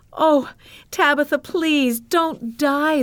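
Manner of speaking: high, pleading cry; begging. A narrator's reading. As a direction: plead desperately with a dying friend. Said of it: "the widow begged".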